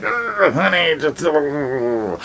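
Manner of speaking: high strained groan into weak words; mock a man hurting, pleading; pained groan. Husband shocked by the transmitter, groaning to his wife.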